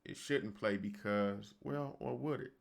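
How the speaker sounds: ordinary talk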